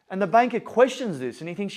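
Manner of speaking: ordinary talk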